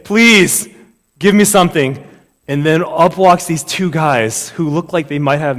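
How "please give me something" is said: louder, higher beggar's plea; mildly hammed-up begging, loud and high; acted pleading. Acting the beggar pleading daily.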